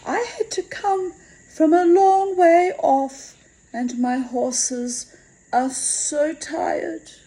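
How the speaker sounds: put-on high, slow, sweet young girl's plea; a lightly voiced girl sighing over her weary horses. A narrator's reading. A mysterious girl excuses herself to her hostess, high and slow.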